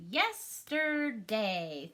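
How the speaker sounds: Yesterday drawn out slowly, each syllable apart: yes-ter-day. Shown as a demonstration. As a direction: sound out Yesterday slowly for kids counting syllables. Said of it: "How many syllables does yesterday have?".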